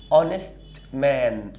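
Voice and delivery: اونست مان said slowly, syllable by syllable; teacher modelling an honest man; slow model phrase. Shown as a demonstration. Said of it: "ال او الآن دي للمفرد".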